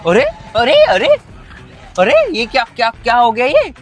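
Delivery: alarmed surprise. Acted. Son panics as the car suddenly breaks down, alarmed. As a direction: yelp in shock as the car fails.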